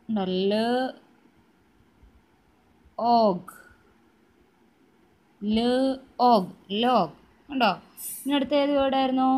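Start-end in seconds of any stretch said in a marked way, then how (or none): 0.2-3.5 ല alone, long pause, then a loud ഓഗ്; sounding out. Shown as a demonstration. Teacher writing the word for children, sounding out ല, ഓഗ്. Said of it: "sound പറഞ്ഞ് പഠിക്കുന്നതാണ്". 5.4-7.2 ല, ഓഗ്, then blended ലോഗ്, loud; sounding out. Shown as a demonstration. Teacher reading back the written word, sounding out ലോഗ്. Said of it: "sound പറഞ്ഞ് പഠിക്കുന്നതാണ്".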